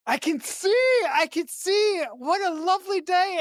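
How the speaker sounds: high, delighted mock cry of wonder; a blind man amazed he can see, overjoyed; Blinken's joyful cry. Retelling Blinken getting up from his fall, mock overjoyed and high. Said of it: "he's like".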